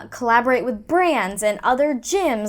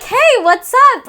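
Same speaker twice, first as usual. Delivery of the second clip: high, loud mock text-message hello; peppy greeting; 13 semitones higher, 10 dB louder than usual. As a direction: call it out like an overeager greeting. Voices the automated text greeting.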